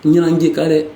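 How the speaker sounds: shouted nira; demo shout. Shown as a demonstration. Teacher showing how to shout the word. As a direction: call the word out loud. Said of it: "je vais crier tout à l'heure".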